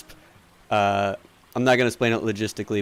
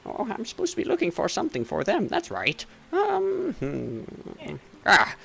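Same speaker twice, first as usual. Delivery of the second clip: absent-minded, higher merchant mumble; shopkeeper muttering to himself; 10 semitones higher, 4 dB quieter than usual. Acted. Shopkeeper looking for something for customers, muttering absent-mindedly. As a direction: remember your errand as you mutter. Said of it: "he's, like, muttering to himself".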